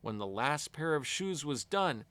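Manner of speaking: ordinary delivery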